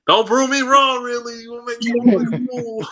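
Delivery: high, loud mock plea, half laughing; mock pleading. Host to absent player, high mock-pleading whine. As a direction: beg him not to embarrass you, hammed up.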